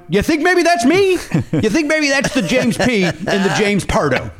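high, incredulous, sarcastic; exasperated retold retort. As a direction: throw it back at the clueless clerk. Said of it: "Jimmy Pardo losing his, uh, temper".

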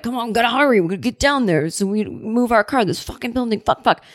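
imitating an irritated, hurried grumble; mildly snappy mock delivery guy; snapping at partner. Furniture guy hurrying his silent partner, snapping. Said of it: "he's, like, kinda snapping at his partner".

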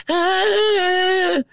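high hammed-up shriek; mock scream. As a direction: squeal like a wimpy horror heroine. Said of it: "she's like".

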